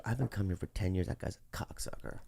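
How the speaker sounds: hushed, quick badmouthing; quietly confided complaint, retold; confidential complaint. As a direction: drop to a near-whisper for the insult. Retold confiding to other manager.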